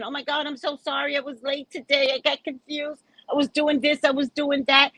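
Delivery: high, gushing string of excuses; apologetic client. Retold or imitated. Client apologizing for being late today, high and apologetic.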